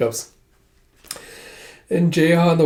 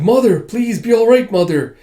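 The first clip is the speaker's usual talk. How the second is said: higher, urgent nightmare cry; acted anxious pleading; distressed child's plea; 7 semitones higher, 4 dB louder than usual. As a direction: plead for mother, pitch raised. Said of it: "having another nightmare with Lady Yonhee".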